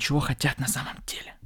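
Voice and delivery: hushed dramatic whisper. Acted. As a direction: drop to a whisper for effect. Motivational talk, dramatic point.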